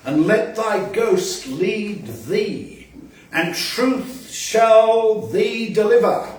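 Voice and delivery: loud, slow, declaiming each line; grand old verse read aloud with rising force; poem recital. Acted. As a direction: proclaim it like a solemn sermon. Reads Chaucer poem aloud.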